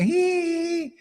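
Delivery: high, silly tittering laugh; mock giggle. Guru mocking his student's giggle, high mock titter. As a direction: titter like a smug devotee. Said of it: "a little, like a Johan thing".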